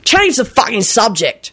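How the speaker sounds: high-pitched exasperated rant; rude snap. A narrator's reading. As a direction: snap rudely at a tiresome friend. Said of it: "he snapped rudely".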